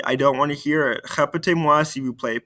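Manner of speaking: ordinary talk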